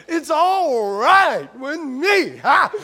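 loud, slow preacher wail capped with "Ha!"; revival preacher. Acted. Pastor tells congregation he likes church like that, in hammed-up preacher wail. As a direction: stretch it out like a hammed-up preacher, then punch "Ha!". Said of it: "I like church like that".

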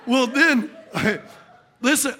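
ordinary talk

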